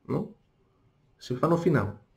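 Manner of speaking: ordinary talk, nothing marked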